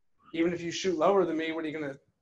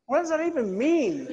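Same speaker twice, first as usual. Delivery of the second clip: exasperated; 9 semitones higher, 3 dB louder than usual. Retold or imitated. Exasperated retort to teacher.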